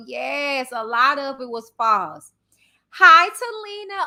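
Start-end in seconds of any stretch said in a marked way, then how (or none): 2.9-4.0 higher, louder bright greeting; excited hello. Real, not acted. Host spotting a viewer in chat, excited greeting. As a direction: call out a happy hello.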